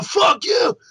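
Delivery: high, loud, hammed-up fury; Todd Howard's powerful roar. Podcaster acting out the developer's rage, powerful mock-furious shout. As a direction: roar it with full mock outrage. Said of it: "it's a really powerful "fuck you".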